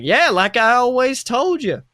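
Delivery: loud, high dad voice cracking a pun; dad's cheesy joke. Acted. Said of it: "He's just like".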